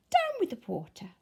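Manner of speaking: usual speaking voice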